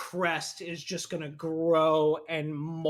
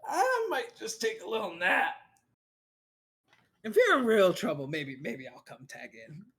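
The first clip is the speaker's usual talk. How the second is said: high demon sidekick voice, dropping away; reluctant demon begging off for a nap; demon companion; 4 semitones higher than usual. Acted. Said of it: "He's like".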